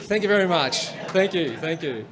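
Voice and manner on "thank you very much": ordinary delivery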